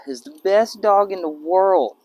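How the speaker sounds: slow, loud and high, gushing over the dog; doting praise. Real, not acted. Owner gushes about his dog to viewers, slow loud praise. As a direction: draw out the praise, beaming.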